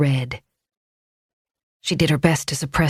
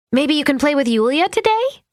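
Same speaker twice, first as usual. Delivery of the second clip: cajoling; 11 semitones higher than usual. A narrator's reading. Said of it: "she cajoled".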